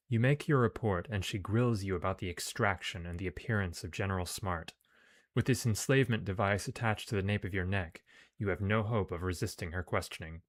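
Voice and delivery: measured, projected story reading; story narration. A narrator's reading. Player reads the game's mission text aloud, narrating. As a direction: read it out like an adventure tale.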